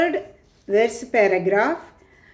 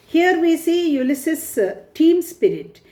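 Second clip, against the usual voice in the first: normal talking voice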